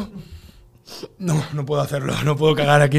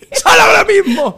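high, loud, slow furious order; retold owner at his limit; furious shriek; 9 dB louder than usual. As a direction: scream the command, drawn out.

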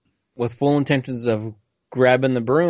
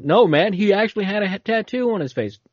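higher, pushy witness voice; insistent witness; 8 semitones higher than usual. Retold or imitated. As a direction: insist on the tattoo. Acted witness insisting again.

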